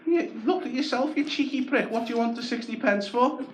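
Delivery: high, worked-up rant at the beggar; shrieking mock outrage; mock angry rant. Shouting back at the beggar.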